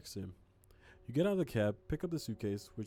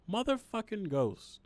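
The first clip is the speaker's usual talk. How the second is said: high, loud, drawn-out curse; frustrated swearing at a ghost; exasperated curse; 10 semitones higher, 5 dB louder, slower than usual. Real, not acted. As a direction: spit it out in disbelief. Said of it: "cursing the ghost".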